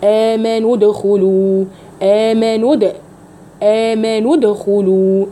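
the د in ادخلوا bounced with a slight echo; dal with qalqala. Shown as a demonstration. Teacher shows her students the qalqala on the د, said slowly. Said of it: "فيها قلقلة".

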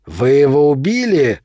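menacing question, rising sharply; full of threat. A narrator's reading. Albino vampire asks boy about a killing, menacing. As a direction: demand it with threat, voice swooping up. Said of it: "Голос его был полон угрозы".

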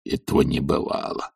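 hoarse low murmur; baffled servant's undertone; puzzled thought. A narrator's reading. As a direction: muse softly to yourself. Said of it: "думал Захар".

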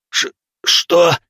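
stuttered, high "what"; shocked stammer. A narrator's reading. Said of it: "пробормотал он".

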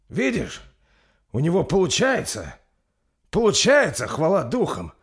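high, loud, elated relief; Торстейн relieved. Acted. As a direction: cry out thanks as hope returns. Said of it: "выдохнул Торстейн".

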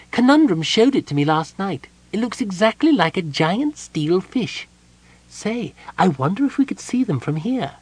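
high, bright-eyed kender chirp; perky kender greeting at the door. A narrator's reading. Kender greets Palin at the door, high and perky.

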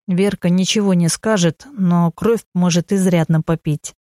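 usual speaking voice

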